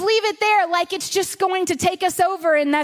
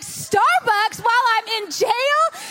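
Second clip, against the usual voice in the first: high, drawn-out incredulous question; mock-indignant disbelief; 7 semitones higher, slower than usual. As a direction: act out outraged disbelief, stretching the words upward. Retold indignant reply to friend.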